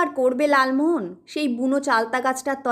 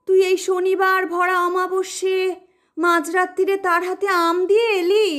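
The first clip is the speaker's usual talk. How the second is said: lamenting wail; 6 semitones higher than usual. Acted. Mother lamenting the omen.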